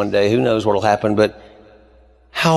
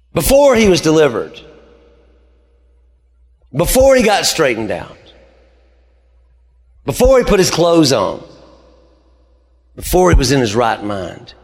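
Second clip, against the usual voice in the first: loud, high, rising preacher's build; fiery pulpit climax; preaching at full force; 5 semitones higher, 4 dB louder than usual. Acted. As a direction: hammer each before louder. Preacher builds repeated before-clauses.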